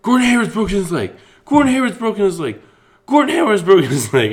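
high, loud, frantic announcer scream; screaming sportscaster impression. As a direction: shout it in horror like a commentator calling an injury live. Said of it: "just starts screaming".

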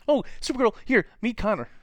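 very high, loud sing-song; mock introduction. Cheerful introduction while Superman cries, very high mock-bright. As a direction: introduce him as if nothing is wrong.

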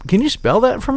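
high, straight-faced mock request; mock polite. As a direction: ask it high and deadpan. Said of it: "you just have to say".

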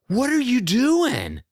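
hammed-up affronted owner; indignant dog owner. Dog owner scolding the runner she knocked down, high mock indignation. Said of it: "And the lady's like".

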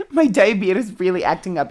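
normal talking voice

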